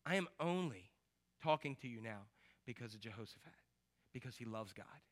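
low muttered retort. Acted. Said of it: "And so Elisha says".

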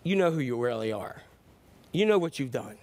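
low, measured insinuation; a hint of a sinister, low accuser; lightly voiced devil's whisper. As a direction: lightly play the accuser in someone's ear. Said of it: "to whisper in our ear, and pretty much say".

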